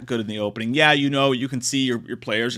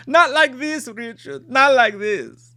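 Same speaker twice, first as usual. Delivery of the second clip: high, anguished mock protest; hammed-up tragic appeal; mock dramatic plea; 9 semitones higher, slower than usual. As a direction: plead melodramatically, drawing out the last words.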